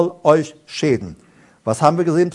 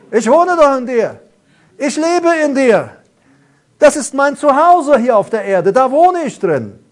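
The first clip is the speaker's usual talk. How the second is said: tender voice of God; 7 semitones higher than usual. Retold or imitated. The Lord answering the praying believer, tender and reassuring. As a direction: gently say that he lives within.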